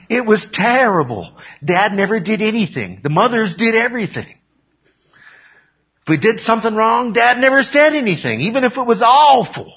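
high-pitched, hammed-up grievance; mock complaining. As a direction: pile up excuses in a high voice. Acting Joseph making juicy excuses.

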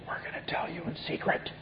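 whispered, as if sharing a forbidden secret. Acted. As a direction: hush it like a secret warning. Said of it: "the Gnostic teachers who would say".

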